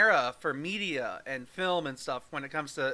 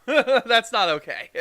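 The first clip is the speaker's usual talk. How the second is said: laughing; 5 semitones higher, 9 dB louder, slower than usual. Real, not acted. Laughing at princess idea.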